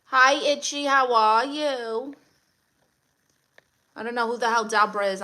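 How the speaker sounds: ordinary talk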